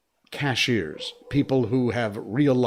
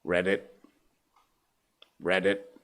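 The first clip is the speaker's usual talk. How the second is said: frog voice; 4 semitones lower, 4 dB quieter than usual. Acted. Frog answering the chicken from the lily pad, lower croak.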